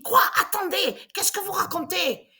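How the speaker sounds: high-pitched mock yell, a laugh slipping in; retold yell. Snapping back at the carer in the corridor, high mock yell. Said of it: "mais j'ai crié, mais tellement fort".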